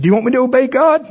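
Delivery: high, fast, anxious question; mock jittery plea for approval; nervous asking. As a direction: seek her permission quickly. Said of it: "I said".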